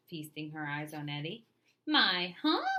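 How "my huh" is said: stuttering. A narrator's reading. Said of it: "Eddie stuttered".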